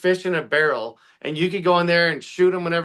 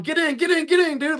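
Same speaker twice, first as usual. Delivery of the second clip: much higher, hurried calls; mock rescuer calling out; 12 semitones higher than usual. Boat crew calling to flood victims, high excited shouting. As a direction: wave people aboard the boat, urgently.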